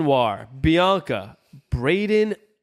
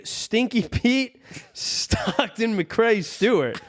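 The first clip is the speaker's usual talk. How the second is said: laughing; 7 semitones higher, slower than usual. Real, not acted. Reading funny Patreon names.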